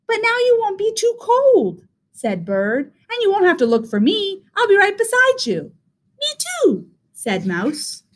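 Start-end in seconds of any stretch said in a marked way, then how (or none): 0.1-1.9 high, cheery bird voice; high-pitched storybook bird; little bird. A narrator's reading. Bird reassures Rabbit in story. 3.1-5.7 high, cheery bird voice; high-pitched storybook bird; little bird. A narrator's reading. Bird reassures Rabbit in story. 6.2-6.9 very high little mouse squeak; teeny high-pitched mouse voice; tiny mouse. A narrator's reading.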